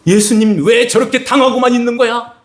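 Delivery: high, exasperated protest; a touch of fuming disbelief; angry frustration. Acted. Peter fuming as Jesus is beaten, angry. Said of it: "화가 나가지고".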